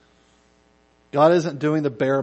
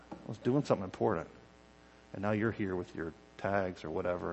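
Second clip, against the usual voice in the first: softer, lower and fast, a put-upon clerk; bored clerk; 7 semitones lower, 11 dB quieter than usual. Retold or imitated. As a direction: brush the customer off like a weary DMV clerk. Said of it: "they're like".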